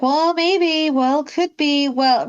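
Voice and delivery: a timid mother who dodges a real answer; hesitant mother. Retold or imitated. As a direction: hedge every answer. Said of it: "Belle's just like".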